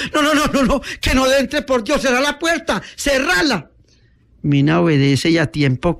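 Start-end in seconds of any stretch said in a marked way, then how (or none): 0.1-3.7 high, panicked pleading cry; shouting in horror. Acted. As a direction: frantically beg for the door to be shut.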